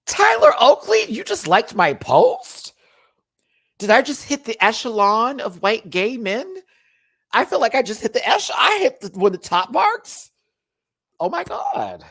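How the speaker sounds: high, loud squeal of disbelief; starstruck shock. Real, not acted. Seeing a famous person liked the post, starstruck. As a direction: gush in astonished excitement.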